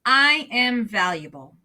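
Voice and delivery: slow and deliberate, echoing back; slow echoed affirmation. Shown as a demonstration. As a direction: repeat it slowly and firmly. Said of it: "you're gonna say it back to me".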